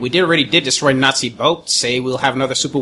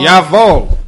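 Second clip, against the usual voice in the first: mock German accent, barked loud; a hammed-up German soldier; 2 semitones higher, 9 dB louder than usual. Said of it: "can you not tell from my German accent".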